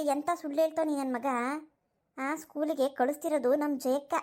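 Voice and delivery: ordinary talk, nothing marked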